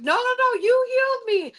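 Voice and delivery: very high-pitched imitation of an excited woman; imitated insistent protest. Grateful woman insisting to the healer, high excited protest. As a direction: protest in a high, acted squeal.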